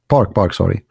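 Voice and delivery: ordinary talk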